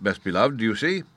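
ordinary delivery, nothing marked

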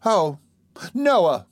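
high, drawn-out wounded exclamation; reproachful. A narrator's reading. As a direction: reproach him, pitched well up. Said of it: "cried Charlotte reproachfully".

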